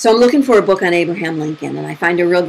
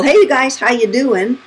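raised, hearty mock hello to a group; higher-pitched retold hello; retold greeting; 7 semitones higher, 3 dB louder than usual. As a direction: imitate someone greeting a group. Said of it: "he greets the people in the pool".

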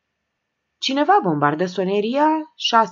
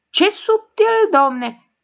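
much higher, loud slow outburst; exploding; 7 dB louder, much slower than usual. A narrator's reading. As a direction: blow up, stretching each word. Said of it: "explodă Nelu Ciprian".

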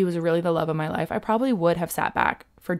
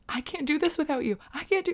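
high, tearful plea, retold from the breakup; desperate pleading; 9 semitones higher than usual. To her ex during the breakup, a high desperate plea. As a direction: beg him not to leave, voice pitched up.

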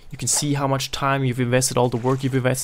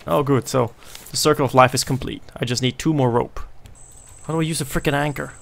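ordinary delivery

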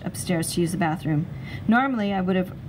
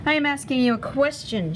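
angry; 7 semitones higher than usual. A narrator's reading. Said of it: "she reminded me angrily".

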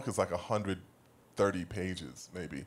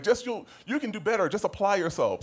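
mock condescending; 10 semitones higher than usual. Mocking condescending advice.

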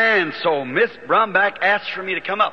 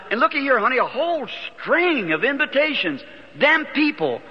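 happy; 8 semitones higher than usual. Retold or imitated. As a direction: show off the good news to his wife. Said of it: "Why you sound so happy".

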